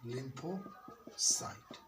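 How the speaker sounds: "lymphocyte" in two drawn-out parts, lympho, pause, cyte; syllable-split dictation. Shown as a demonstration. Teacher dictating a new term to pupils, slow "lympho... cyte". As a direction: break "lymphocyte" slowly after lympho, softly.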